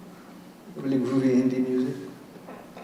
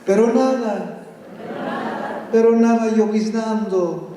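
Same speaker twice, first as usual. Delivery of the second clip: slow, rhythmic Spanish poem reading; dramatic Spanish verse reading; poem recital; slower than usual. Acted. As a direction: chant the verses like an incantation. Reads a Spanish poem aloud.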